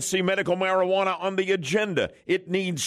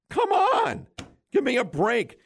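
sharp high exasperated outcry; 7 semitones higher than usual. Real, not acted. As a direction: throw up your hands, fed up. Host ranting at city rules.

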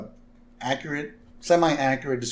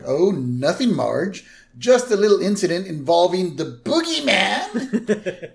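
casual cover-up climbing higher; Homer; 6 semitones higher, 4 dB louder than usual. Retold or imitated. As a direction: play it down, then burst out on the last word. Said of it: "And Homer's like".

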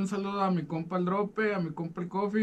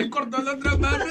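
laughing; 4 dB louder than usual. Real, not acted.

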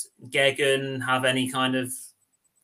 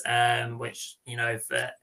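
usual speaking voice

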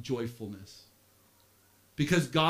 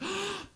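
sharp high offended gasp; a sudden offended intake of breath; mock shocked gasp; 7 dB quieter than usual. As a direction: gasp as if insulted. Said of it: "Some of you are like".

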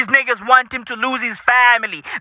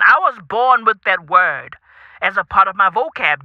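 ordinary talk

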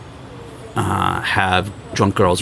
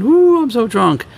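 put-on high squealy tipsy party girl; mock drunk girl; 13 semitones higher, 4 dB louder than usual. Mocking drunk party girls.

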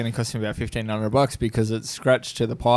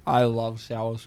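talking through a chewy sweet; words round a mouthful; mouth full; 3 semitones lower, 5 dB quieter, much slower than usual. Real, not acted. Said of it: "as soon as you took a bite".

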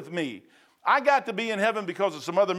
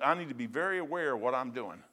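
usual speaking voice

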